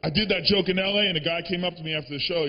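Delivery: usual speaking voice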